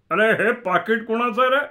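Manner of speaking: loud, high-pitched public query; calling out. Acted. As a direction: call out a question to everyone. Voice calls out from behind.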